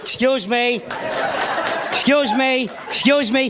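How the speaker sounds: menacing Italian father, lightly voiced; slow tough-guy warning; mild De Niro-style threat; gruff father-in-law. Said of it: "He said everything three times".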